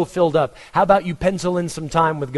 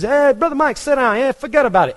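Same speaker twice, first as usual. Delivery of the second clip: put-on New York accent; 9 semitones higher, 3 dB louder than usual. Pastor quoting his New York friend in a New York accent. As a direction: imitate the Brooklyn friend greeting you. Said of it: "Brother Costa, he says".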